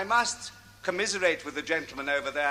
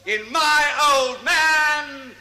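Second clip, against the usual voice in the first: slow, rising, ever louder build to the name; building announcer's shout; 9 semitones higher, 5 dB louder, slower than usual. Acted. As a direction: drag it out and then bellow the name. Compere introduces next act.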